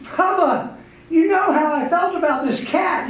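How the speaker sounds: high-pitched exasperated protest; upset, exasperated. Acted. Upset owner scolds Bubba over cat.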